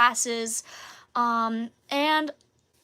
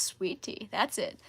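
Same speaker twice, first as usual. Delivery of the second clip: lower, softer, terse; deep-voiced counselor; 3 semitones lower, 7 dB quieter than usual. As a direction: mumble it like a man of few words, low and quiet. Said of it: "he's got a very deep voice".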